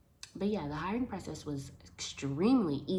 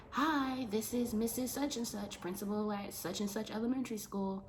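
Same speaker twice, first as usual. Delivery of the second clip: higher, polite phone voice; principal on phone; 6 semitones higher than usual. Retold or imitated. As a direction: sound like a cheery principal calling. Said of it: "she's like".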